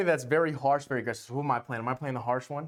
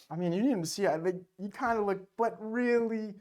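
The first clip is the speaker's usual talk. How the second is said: huffy flustered denial; 6 semitones higher than usual. Pretend. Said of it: "pretend to be a tsundere".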